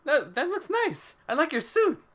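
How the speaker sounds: very high, put-on impressed voice; high-pitched awkward compliment; acted admiring hero. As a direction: admire it in a high little character voice. Acting out the superhero cameo.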